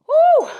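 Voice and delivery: loud high whoop of relief; excited whoop. Real, not acted. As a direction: let out a big victory whoop. End of a hard set.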